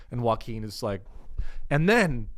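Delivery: ordinary talk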